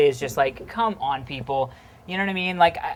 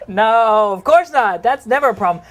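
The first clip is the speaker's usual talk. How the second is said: mock protest; 5 semitones higher, 6 dB louder than usual. Mock denial after sex complaint.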